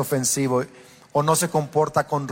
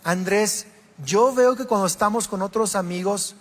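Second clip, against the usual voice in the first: esposa; 6 semitones higher than usual. Retold or imitated. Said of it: "Kelly me decía".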